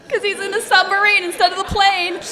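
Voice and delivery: loud and high through laughter; laughing explanation. Real, not acted. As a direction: laugh out the punchline for the audience.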